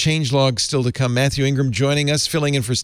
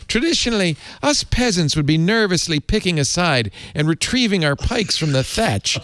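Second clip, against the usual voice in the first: reading in a mock Irish accent, the last line slowed; a hammed-up Irish lilt for the quoted column; amateur Irish accent reading a newspaper quote; bad Irish accent; 8 semitones higher than usual. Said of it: "I apologize for my bad Irish accent".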